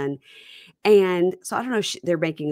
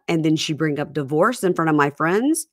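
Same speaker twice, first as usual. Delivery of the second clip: normal talking voice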